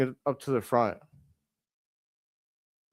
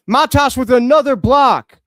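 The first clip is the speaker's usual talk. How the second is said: louder, higher thrilled call of the block; excited shout; 8 semitones higher, 11 dB louder than usual. Real, not acted. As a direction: roar it like the crowd just stood up. Fan calls a big block.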